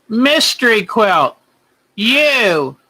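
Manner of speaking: ordinary talk, nothing marked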